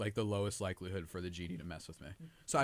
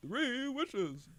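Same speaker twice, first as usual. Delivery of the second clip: much higher theatrical genie voice; booming genie voice; 13 semitones higher than usual. Acted. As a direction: announce it like a grand genie. Playing the genie.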